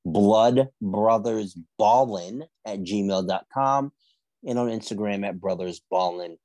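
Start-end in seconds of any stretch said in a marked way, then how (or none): 0.1-3.9 slow down so listeners can write it down; slow and clear. Shown as a demonstration. Host repeating the email for listeners, slow and deliberate. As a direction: spell out the email slowly, word by word.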